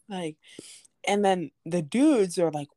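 ordinary talk

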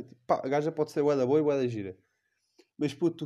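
ordinary talk

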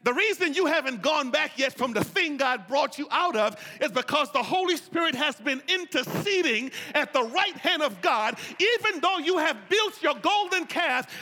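loud, high, climbing preacher voice; loud, high preaching; full-force preaching. Acted. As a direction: preach it to the rafters.

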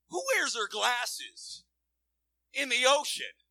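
incredulous. Real, not acted. Incredulous aside to the audience.

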